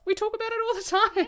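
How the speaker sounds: loud, high, playful near-shout; gleeful proclamation. Acted. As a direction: proclaim it openly, the opposite of whispering. Gleeful contrast to earlier whispering.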